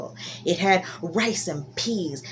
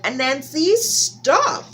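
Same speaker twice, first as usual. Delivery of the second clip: much higher, louder sharp stop; Turtle scolding; 7 semitones higher, 4 dB louder, much slower than usual. Acted. Turtle stopping Anansi at his table, high loud stop.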